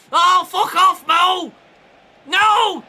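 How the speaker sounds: real high, loud cry of dismay; outraged gamer's shout at conceding; exasperated shout. Real, not acted. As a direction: yell at the player who just scored. Conceding a goal in the game.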